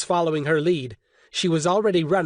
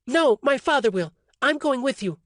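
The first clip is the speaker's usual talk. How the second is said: higher woman's voice, firm; Orly, defiant; 8 semitones higher than usual. A narrator's reading. Orly refusing Alton's order, defiant woman's voice. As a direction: insist you're coming along.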